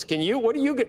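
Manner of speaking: normal talking voice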